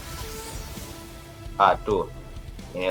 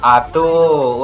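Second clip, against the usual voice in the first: louder, higher drawn-out groan of alarm; dismayed cry; 4 semitones higher, 6 dB louder than usual. Real, not acted. Gamer seeing the boss's ultimate return, dismayed cry. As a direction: cry out as trouble comes back. Boss uses ultimate again.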